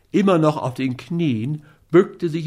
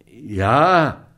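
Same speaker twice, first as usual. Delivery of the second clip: a touch of grudging grumble; grumbled; 3 dB louder than usual. A narrator's reading. Schmidt backs away from Scott, mildly grumbling. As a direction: grumble a reluctant assent, backing down. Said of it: "brummte Schmidt zurückweichend".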